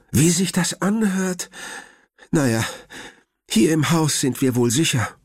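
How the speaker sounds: high, lightly voiced worried grandmother; grandma's voice. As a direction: speak up high as the fussing grandma. (hohe, besorgte Oma-Stimme)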